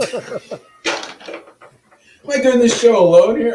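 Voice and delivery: loud, high, hammed-up complaint; mock exasperation. Real, not acted. Host teasing his silent co-host, loud and mock-exasperated. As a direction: whine it out like you've been abandoned.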